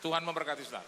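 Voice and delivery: loud, high proclamation; emphatic blessing. Real, not acted. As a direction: bless them with full force. Preacher blesses congregation. (berkat yang lantang)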